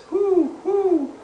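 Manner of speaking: high, hollow owl call; owl hoot. Shown as a demonstration. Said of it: "What sounds does an owl make?".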